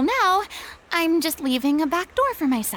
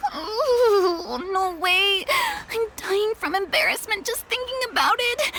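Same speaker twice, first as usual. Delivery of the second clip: higher, mortified "no way"; embarrassed protest; 6 semitones higher than usual. Acted. Imagining begging former colleagues.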